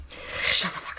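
hissed whisper, voiceless. Retold or imitated. Girls hushing a friend, whispered. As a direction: whisper it as a hush. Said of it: "everyone's like".

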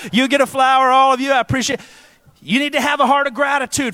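loud, high fervent preacher; preaching at full force. Acted. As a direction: drive it home to the congregation.

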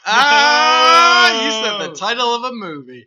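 mock outrage. Mock outrage at saying movie title.

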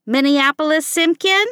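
exasperated mother calling out; mother's yell. A narrator's reading. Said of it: "yelled Mom".

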